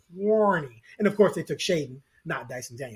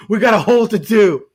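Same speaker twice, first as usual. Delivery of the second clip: loud, hammed-up fan whine; mock the complainers, laughing around it; mock crying complaint; 3 semitones higher, 10 dB louder than usual. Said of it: "and then people cry".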